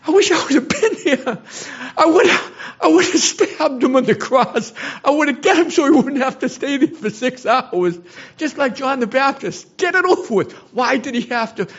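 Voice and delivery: real anguish, pitch soaring high; emotional. Real, not acted. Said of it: "Forgive me for getting emotional".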